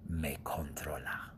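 breathy menacing whisper. Acted. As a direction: hiss it like a secret threat. Susurro del personaje. (susurro amenazante)

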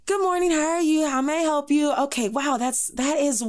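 high, put-on Valley Girl customer greeting. Shown as a demonstration. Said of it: "use the Valley Girl voice".

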